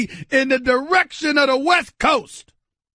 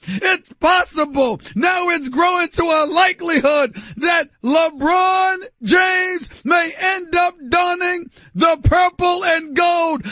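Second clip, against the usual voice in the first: usual speaking voice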